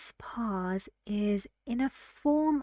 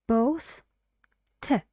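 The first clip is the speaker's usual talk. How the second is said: ordinary talk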